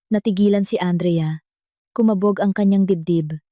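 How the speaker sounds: ordinary delivery, nothing marked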